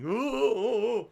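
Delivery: loud, high "ooh" noises; high mock wailing ooh. Streamer acts out falling over with boxes, high wailing. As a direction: wail a string of high oohs.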